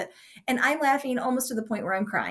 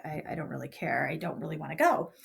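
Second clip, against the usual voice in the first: put-on low, soft, sulky grumble of a kid; sulky complaint; 6 semitones lower, 4 dB quieter than usual. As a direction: mope it out like a kid dreading the dentist. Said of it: "I would complain and be like".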